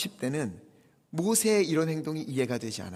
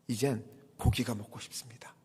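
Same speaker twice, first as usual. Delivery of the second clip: whispered craving; 6 semitones lower, 7 dB quieter than usual. Retold or imitated. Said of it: "떼를 쓰며 울었어요".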